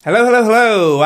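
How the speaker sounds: bright, loud sing-song hello; loud greeting. Real, not acted. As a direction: belt out the show greeting. Host's signature show greeting.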